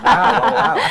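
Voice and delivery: loud, high gasp of amazement; amazed shout. Real, not acted. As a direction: shout wow at the crash. Sudden crash on screen.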